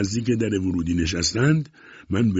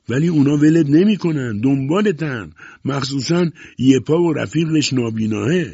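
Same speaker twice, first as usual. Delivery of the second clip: high, loud argument; insistent warning; 6 semitones higher, 6 dB louder than usual. A narrator's reading. Newcomer warns captain they will follow him, insistent. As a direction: press the warning loudly.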